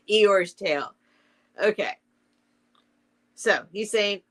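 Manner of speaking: usual speaking voice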